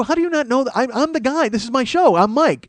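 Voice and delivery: loud, high, exasperated: how do you not know; exasperated outburst. Retold or imitated. Comedian retelling telling off an oblivious guest, exasperated yell.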